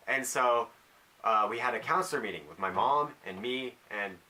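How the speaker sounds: normal talking voice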